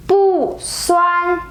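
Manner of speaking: "bu" falls from high, then "suan" stays high and level; unchanged fourth tone. Shown as a demonstration. Teacher showing bu before first tone: falling "bu", high level "suan". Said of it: "bu stays the same when it goes with the".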